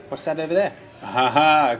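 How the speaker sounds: usual speaking voice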